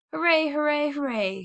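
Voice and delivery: high, loud hoorays fading at the end; children's cheer. Acted. The Woodlawn children cheering, high loud hooray then fading. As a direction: cheer all together. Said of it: "shouted the Woodlawn children".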